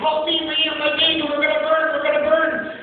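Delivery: high mock cries of panic; screaming for help. Trapped mother pleading for her baby, high mock scream. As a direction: wail it high without extra volume. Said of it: "The lady's still screaming".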